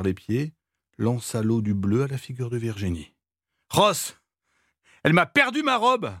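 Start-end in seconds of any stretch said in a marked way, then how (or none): 3.7-6.1 much higher, shouted accusation; outraged cry. A narrator's reading. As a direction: yell it, drenched and furious. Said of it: "cria celle-ci". (cri outré, bien plus aigu)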